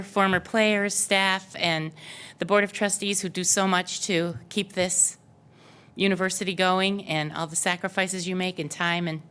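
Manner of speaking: ordinary delivery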